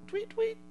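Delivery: high chirping tweet; bird tweet. Pretend. Preacher imitates the dove.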